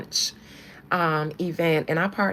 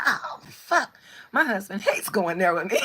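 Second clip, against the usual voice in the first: mock dismay; 4 dB louder than usual. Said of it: "And I'm like".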